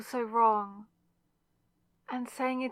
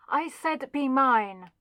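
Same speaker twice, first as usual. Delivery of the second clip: louder, higher, insistent command; forceful demand; 4 semitones higher, 6 dB louder than usual. Acted. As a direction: demand it, leaning hard on the last word. Demands love after being ignored.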